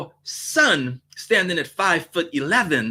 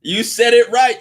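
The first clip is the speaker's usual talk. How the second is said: ordinary talk, nothing marked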